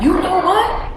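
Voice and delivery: loud, high-pitched indignant challenge; mock angry. Snapping back at someone who beeped, loud mock angry. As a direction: blow up in a retold outburst, voice shooting up. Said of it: "You know, angry".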